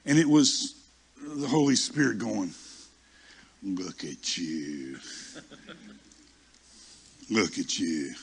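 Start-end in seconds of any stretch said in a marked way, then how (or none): 3.6-8.1 slow, low, drawn-out needling; mock admiration. Holy Spirit teasing the pouting preacher, slow low mock admiration. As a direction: draw it out low, teasing a show-off.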